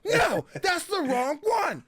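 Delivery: very high, drawn-out mock complaint; mock outrage. Host imitates friend spotting the wrong accent, in mock outrage. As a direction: wail it slowly in exaggerated dismay.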